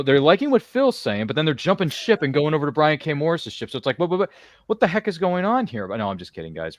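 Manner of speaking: ordinary delivery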